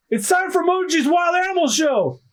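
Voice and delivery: high shouted showman call; circus announcement. Acted. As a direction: announce the show to a crowd.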